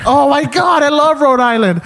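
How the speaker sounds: very high, loud shriek of delight; thrilled old lady. Retold or imitated. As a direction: imitate old lady calling out to her husband. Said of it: "this old lady answered the phone".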